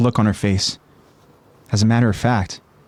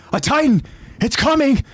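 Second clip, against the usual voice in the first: alarmed warning; 13 semitones higher than usual. Acted. Bertolt warns.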